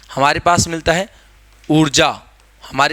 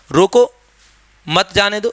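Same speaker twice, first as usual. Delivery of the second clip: रोको set apart, then a break before मत जाने दो; pause after रोको; 4 semitones higher than usual. Shown as a demonstration. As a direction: say रोको, stop, then run मत जाने दो together. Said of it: "अब दोनों तरीके से हो सकता है". (रोको के बाद विराम)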